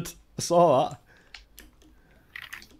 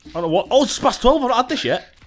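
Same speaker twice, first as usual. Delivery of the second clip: ordinary talk, nothing marked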